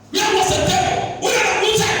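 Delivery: shouted high and loud; shouted question. Acted. Preacher shouting the question back at the church. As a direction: shout it again even harder. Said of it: "repeated question louder".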